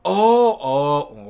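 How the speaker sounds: normal talking voice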